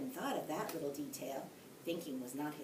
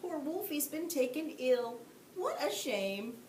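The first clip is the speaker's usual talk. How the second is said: mock sympathy; 7 semitones higher than usual. A narrator's reading. Mock sympathy over fainted wolf.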